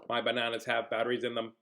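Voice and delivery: low, soft monotone robot; robot monkey. Acted. Robot monkey explains its bananas, low robotic monotone. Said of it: "I am a robot monkey".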